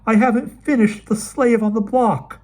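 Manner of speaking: high, loud desperate cry; wife crying out to her husband, high and loud. Acted. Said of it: "Anne cried".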